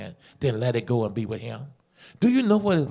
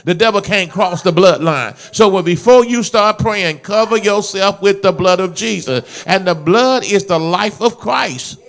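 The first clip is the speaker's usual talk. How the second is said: loud, driving sermon delivery; loud, emphatic preacher; full-force preaching; 4 semitones higher, 7 dB louder than usual. Real, not acted. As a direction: preach it out with force, landing the final phrase.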